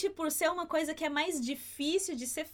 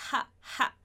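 breathy throat h; 2 semitones lower than usual. Shown as a demonstration. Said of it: "som mais suave que a gente tem no J".

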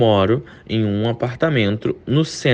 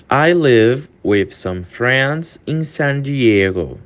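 very slow, word by word; slow model sentence; slow demo; much slower than usual. Shown as a demonstration. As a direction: read it slowly for learners. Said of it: "repeated faster".

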